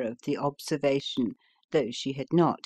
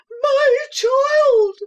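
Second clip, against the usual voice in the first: very high, loud mother's scream; panicked scream; 10 dB louder than usual. Acted. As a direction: scream for her child in terror. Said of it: "screamed Missus Fleetwood".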